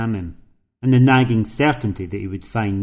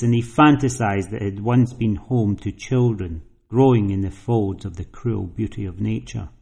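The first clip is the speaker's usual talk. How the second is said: ordinary delivery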